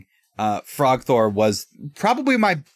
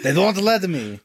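put-on thick Norwegian accent, laughing; 8 semitones higher, slower than usual. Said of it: "say in a thick Norwegian accent".

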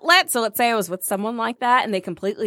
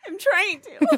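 high, whimpering imitation; mock pathetic; 8 semitones higher, slower than usual. Friend mimicking the date's reply, mock pathetic. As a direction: whine it feebly through held-back laughter. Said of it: "It sounded so pathetic".

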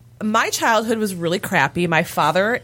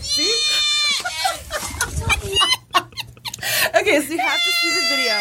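laughing. Real, not acted. Still laughing about the goat.

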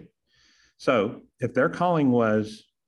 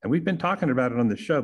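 ordinary talk

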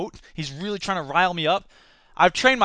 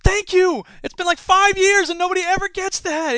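much higher and louder, bursting with delight; thrilled exclamation; 13 semitones higher, 8 dB louder than usual. Retold or imitated. Teacher thrilled a student finally got his reference, elated shout. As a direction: cheer like you've waited years for this. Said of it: "And I was like".

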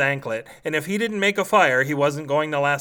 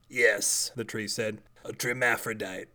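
soft, lower tree voice, matter-of-fact; a grumpy tree politely declining to kill; talking tree; 5 semitones lower, 7 dB quieter than usual. Acted.

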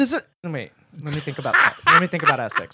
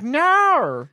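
high, drawn-out mock Australian "nar"; exaggerated Australian vowel, pitched way up; Australian accent; 10 semitones higher than usual. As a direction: mimic TikTokers' bad Australian accent. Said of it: "do an Australian accent".

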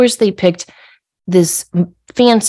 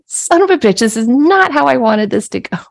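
exasperated huff; 6 semitones higher than usual. Retold or imitated. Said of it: "I sit down with a huff".

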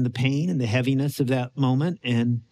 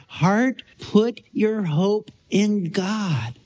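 slow, firm declaration, the words drawn out; declaring to the heart; 4 semitones higher, much slower than usual. Shown as a demonstration. Pastor shows the congregation how to declare to their heart, slowly. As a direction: speak it to your own heart, deliberately. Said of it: "we just need to declare to our heart".

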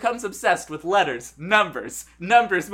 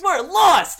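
high, loud catchphrase shout; mock yelling; 10 semitones higher, 6 dB louder than usual. Two lost creators announce themselves, yelling. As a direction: holler it like a team cheer. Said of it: "they just start yelling".

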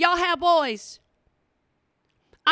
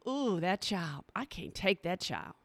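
softer, lower, slow private judgment; hushed lower mock tut-tutting; muttered disapproval; 6 semitones lower, 11 dB quieter than usual. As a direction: say it softly under your breath, judging a photo. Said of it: "looking at stuff on the internet going".

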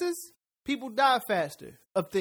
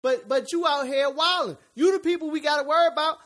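high, hyped Kevin Hart voice; Kevin Hart impression; 6 semitones higher, 4 dB louder than usual. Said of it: "he sounded just like Kevin Hart just now".

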